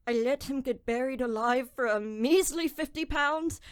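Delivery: ordinary talk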